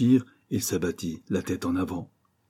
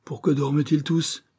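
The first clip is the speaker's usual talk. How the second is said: high, anxious question; bewildered, pitched-up exclamation; alarmed cry; 7 semitones higher than usual. A narrator's reading. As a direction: ask it in rising alarm. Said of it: "s'écria-t-il".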